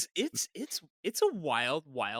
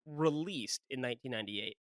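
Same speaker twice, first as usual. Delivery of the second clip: "released" set apart, then trailing off softer; pointed "released", quieter finish; stress on released; 3 semitones lower, 9 dB quieter than usual. Real, not acted. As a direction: lean on "released", drop away on "in nineteen ninety-eight". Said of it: "I'm gonna emphasize released in nineteen ninety-eight".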